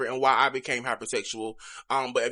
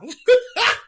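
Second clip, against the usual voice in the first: embarrassed laugh; 9 dB louder than usual. Real, not acted. Embarrassed after tearing up.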